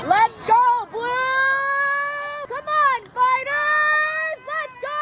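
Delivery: high, shouted cheer for the team; stadium cheer. Real, not acted.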